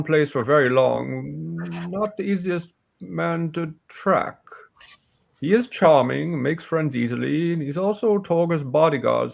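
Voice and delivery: put-on self-assured old warrior briefing hunters on a killer; aging warrior, scornful and certain; old warrior woman. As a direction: lay out the case as a seasoned warrior. Game master voices Hilin.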